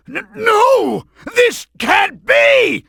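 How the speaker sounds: high, slow, stammering cry of defeat; panicked disbelief. Acted. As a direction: wail that this cannot be happening.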